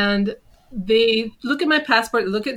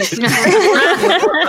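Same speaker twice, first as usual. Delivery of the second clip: slow, louder, higher pleading; begging, drawn out; 8 semitones higher, 7 dB louder, slower than usual. Retold or imitated. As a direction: beg her like a worried husband. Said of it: "the whole time Zen's going".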